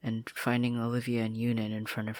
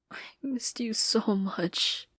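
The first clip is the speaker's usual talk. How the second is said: slow, higher whisper through tears; tearful confession; 10 semitones higher, slower than usual. Acted. Marcy crying into Sasha's neck, whispering. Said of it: "she confessed".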